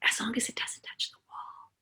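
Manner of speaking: fully whispered warning. Shown as a demonstration. Mother whispering the limit to her son Sam, whisper. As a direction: whisper the limit so the child leans in. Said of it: "I started to whisper".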